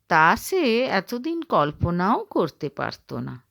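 ordinary delivery

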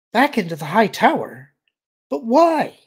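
high, loud alien questioning; alarmed. Acted. Alien shivers at returning to high tower, alarmed. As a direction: leap up in pitch on a dismayed question.

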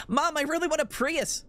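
high, whiny mock-child begging; kid pleading with mom. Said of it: "Like, somehow kids would".

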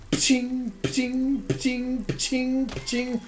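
high, ringing pching noises; imitated metallic pching. Player voicing the metal spikes, imitated metallic pching. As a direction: repeat a high metallic sound effect evenly.

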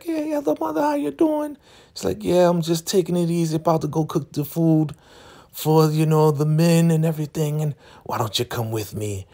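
very high, chirpy mock voice; cheerful churchy lady. Marva greeting another Elder Mother, chirpy high. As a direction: gush with fake cheer.

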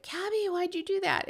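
lifted high, puzzled asking; high-pitched puzzled question to granddaughter. Retold or imitated. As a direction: ask it up high, gently baffled. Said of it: "And we're like".

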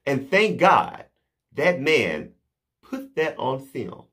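ordinary talk, nothing marked

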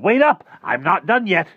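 high, full-force shout; hollering. A narrator's reading. Rictus chasing Harvey to the door, hollering. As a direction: holler urgently to stop him leaving. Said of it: "he hollered".

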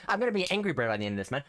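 rushed exasperated line; fast frustrated rant. Real, not acted. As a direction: blurt it out fast in annoyance.

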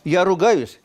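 loud burst of joy; loud and excited. Real, not acted. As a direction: exclaim it loudly, delighted. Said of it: "Нет, я радуюсь".

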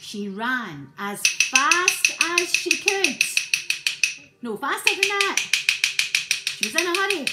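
high, loud hurried telling; urgent narration. A narrator's reading. Storyteller urging Maisie on, loud and high. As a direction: drive the chase.

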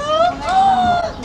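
high sharp gasp of delight; excited gasp. Acted. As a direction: gasp loudly in amazement. Amazed at Mexico.

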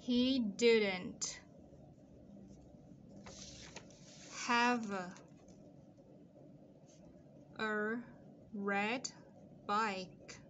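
word by word with long pauses; halting, spaced-out model sentence; slow dictation. Shown as a demonstration. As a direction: leave a long gap after each word for writing. Dictating for students to copy.

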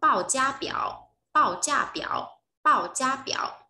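teacher drills "Báo giá biểu"; model pronunciation. Shown as a demonstration. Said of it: "có thể nói là gì".

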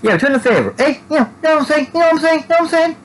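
very high, squeaky hopped-up character; mock downtown druggie. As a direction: manic falsetto, repeating like a verbal tic. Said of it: "I'm a downtown downtown druggie there on meth".

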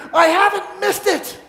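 high-pitched elated exclamation; overjoyed relief. Acted. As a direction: cry it out, giddy with relief. Scrooge's joy on Christmas morning.

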